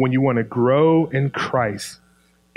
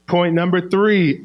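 ordinary delivery

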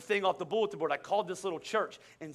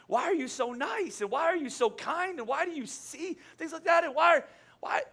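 acted bewilderment, rising higher with each question; baffled amazement; 9 semitones higher than usual. Acting baffled reaction to nice person.

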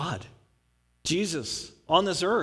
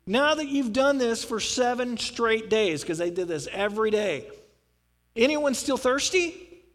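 higher, louder preaching cry; loud calling out; 5 semitones higher than usual. Retold or imitated. Jesus calling the thirsty crowd to come to him, loud. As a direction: call to the whole crowd. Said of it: "he stands up and says".

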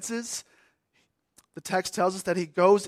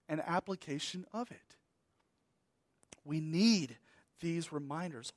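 ordinary talk, nothing marked

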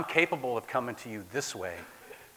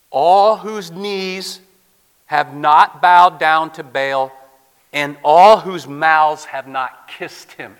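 loud, very slow, every word stretched; slow proclamation; 4 semitones higher, 19 dB louder, much slower than usual. Acted. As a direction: declare it with weight. God's reserve proclaimed.

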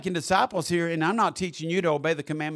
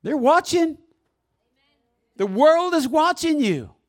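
high, raised, forceful preacher voice; loud preaching warning; 12 semitones higher, 11 dB louder than usual. Real, not acted. Preacher warns congregation the world is watching, loud and high.

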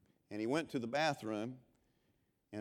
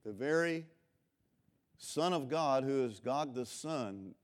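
ordinary delivery

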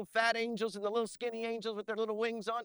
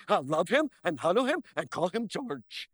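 swooping cartoon creature voice; cartoon character impression; 4 semitones lower than usual. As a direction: put on a doting cartoon-monster voice. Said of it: "alien on Bugs Bunny".

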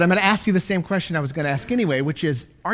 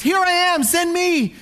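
Isaiah eagerly volunteering to God, high excited cry; 10 semitones higher than usual. Acted. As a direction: leap at the call, throw your hand up. Isaiah leaps up, eager reply.

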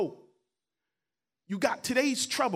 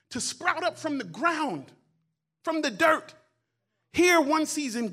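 higher and louder, building into a sung preacher's climb; full-force preaching; 6 semitones higher, 4 dB louder than usual. Acted. Preacher urging the congregation that God cares, at full force. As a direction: lift the church with each rising question.

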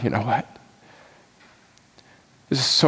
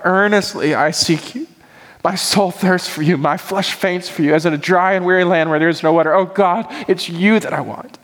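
higher, earnest, pleading prayer voice; prayerful psalm reading; 6 semitones higher than usual. Acted. Pastor praying a psalm to the congregation, raised and earnest. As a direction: cry out the psalm to God. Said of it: "Psalm sixty-three".

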